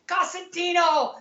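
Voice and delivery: high, drawn-out holler of a surname; excited caller. Retold or imitated. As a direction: imitate a buddy hollering down the phone. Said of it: "my friends are calling me up".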